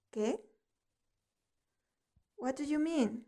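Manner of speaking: usual speaking voice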